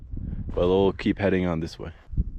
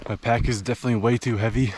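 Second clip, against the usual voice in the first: ordinary talk, nothing marked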